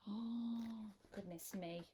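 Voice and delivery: usual speaking voice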